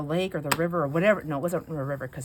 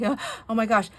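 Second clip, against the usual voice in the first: panicked; 5 semitones higher, 4 dB louder, faster than usual. Retold or imitated. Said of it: "I'm panicking, I'm like".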